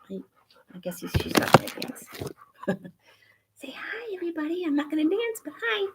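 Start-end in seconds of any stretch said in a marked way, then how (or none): 3.6-5.9 high, soft voice speaking for the dog; dog's voice. Pretend. Speaking for dog Roxy who won't dance, high and soft. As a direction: voice the shy dog saying hi.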